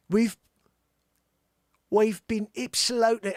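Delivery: put-on shaky grasp of a Cockney accent; failed Cockney attempt. Host struggling to voice [name], bad Cockney accent. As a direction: try Cockney and miss. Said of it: "I can't freaking do a cockney".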